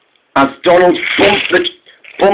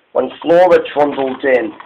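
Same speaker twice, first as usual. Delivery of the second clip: ordinary delivery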